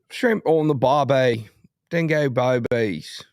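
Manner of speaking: slow, drawn-out mock Australian accent. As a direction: stretch the vowels into a hammy Aussie accent. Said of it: "Any other accents".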